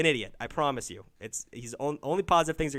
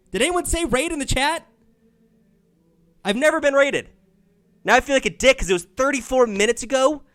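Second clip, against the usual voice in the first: loud, high-pitched disbelief; shocked outburst; 11 semitones higher, 7 dB louder than usual. Real, not acted. Streamer realizing they missed being raided, shocked and loud. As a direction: exclaim in real shock, voice shooting up.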